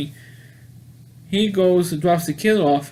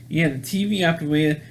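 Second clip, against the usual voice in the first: ordinary talk, nothing marked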